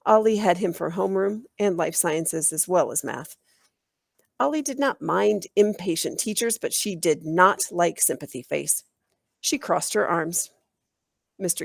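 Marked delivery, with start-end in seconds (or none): none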